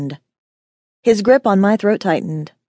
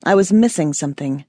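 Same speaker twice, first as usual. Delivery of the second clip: ordinary delivery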